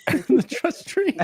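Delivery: high, slow, laughing echo; amused high-pitched repeat. Real, not acted. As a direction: squeak it out through a laugh.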